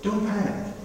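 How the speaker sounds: a hint of an unbothered old man; calm grandfather. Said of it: "he's saying".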